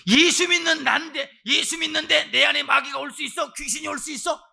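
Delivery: high, loud mock-indignant believer; high-pitched protesting voice. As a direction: act out a loud, disbelieving objection.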